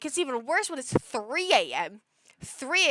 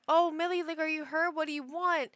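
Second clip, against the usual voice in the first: mildly worried, higher-pitched coo; concerned owner; 5 semitones higher than usual. Retold or imitated. Owner waking to her screaming cat, mildly concerned. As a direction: fuss over the pet a little, higher. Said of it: "And I'm like".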